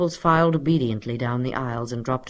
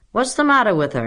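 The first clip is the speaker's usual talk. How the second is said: higher, louder, keen pupil; eager question; 8 semitones higher, 6 dB louder, faster than usual. A narrator's reading. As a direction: ask it eagerly, pitched up. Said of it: "asked Roy Hart eagerly".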